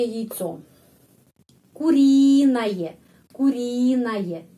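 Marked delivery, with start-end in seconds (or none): 1.7-4.4 куриное slowly, with stress on the И; slow and louder, lean on the stressed И of куриное. Shown as a demonstration. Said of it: "Di sini penekanan". (куриное pelan dengan tekanan pada И)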